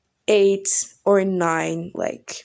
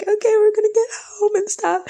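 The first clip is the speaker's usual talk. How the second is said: giddy relief; 12 semitones higher, faster than usual. Retold or imitated. Quoting relieved self.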